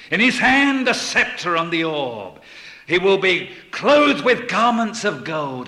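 loud, high, ringing pulpit oratory; full-force preaching. Real, not acted. Preacher proclaiming Christ's return in glory, at full force.

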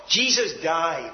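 usual speaking voice

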